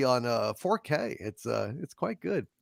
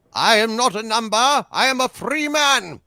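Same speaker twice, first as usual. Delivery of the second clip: high, raised British protest; defiant British prisoner crying out; British accent; 6 semitones higher, 5 dB louder than usual. Acted. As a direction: proclaim it loudly in a British accent. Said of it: "more of an actual British accent".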